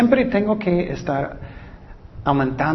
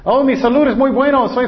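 higher, brighter mock cheer; mock happy; 7 semitones higher, 3 dB louder than usual. Preacher mimicking a healthy person to the congregation, mock cheer. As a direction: gush about good health.

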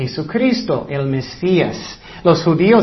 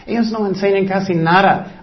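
ordinary talk

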